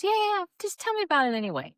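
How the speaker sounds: high, fast brush-off; eager ophthalmologist. Retold or imitated. Ophthalmologist wanting the story from the practitioner, high quick insistence.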